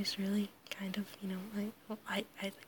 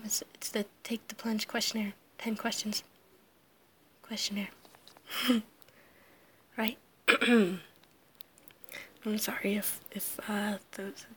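normal talking voice

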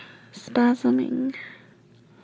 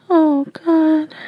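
moaning; 4 semitones higher, 5 dB louder than usual. Acted. Climax scene, moaning aloud.

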